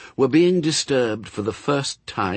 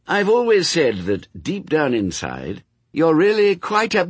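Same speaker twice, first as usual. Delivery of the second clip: beaming; 8 semitones higher, 4 dB louder than usual. Acted.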